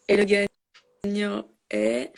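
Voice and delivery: very slow suspenseful drumroll build-up; hyped giveaway announcement. Acted. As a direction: drag out the reveal for suspense. Streamer building suspense before winner.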